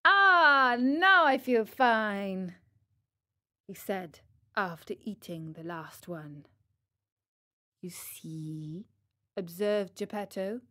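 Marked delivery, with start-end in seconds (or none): 0.1-2.6 contented relief. Acted. Pinocchio after eating the last one, drawn-out contentment. 7.8-8.9 very soft, low near-whisper; hushed knowing aside. Acted. Geppetto observing he was right, soft knowing murmur. Geppetto gently makes his point.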